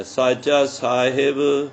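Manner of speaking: ਸਾਚਾ ਸਾਹਿਬ drawn out slowly, with a pause after ਸਾਚਾ; slow, measured recitation with a pause after ਸਾਚਾ; pausing slowly. Shown as a demonstration. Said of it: "ਐਵੇਂ ਰੁਕ ਕੇ ਥੋੜ੍ਹਾ ਜਿਹਾ".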